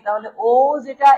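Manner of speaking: normal talking voice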